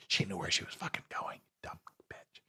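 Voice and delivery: snide whisper under the breath; whispered aside. Acted.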